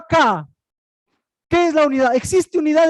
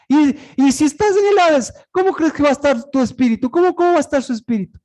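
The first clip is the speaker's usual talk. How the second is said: ordinary talk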